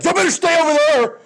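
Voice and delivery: high, squeaky mock threat; squeaky bluster. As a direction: puff up and squeal it. Early America's shrill bluster.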